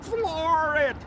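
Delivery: high, loud, drawn-out command; urgent shout. Acted. Sidekick ordering the driver to flee police, urgent loud yell. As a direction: yell it high, desperate to get away.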